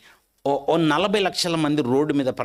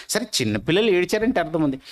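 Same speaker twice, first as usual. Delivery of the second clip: ordinary talk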